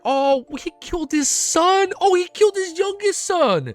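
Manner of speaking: breaking into a pained, high wail; real grief, near crying. Real, not acted. Streamer reading a tragic chapter to chat, wailing in real grief. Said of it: "man, I'm crying".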